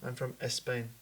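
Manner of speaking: mock Spanish accent, E before the S. Mimicking Spaniards who can't start words with S, Spanish accent. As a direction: put an E before "Spain", like a Spaniard. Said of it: "without putting an E in front".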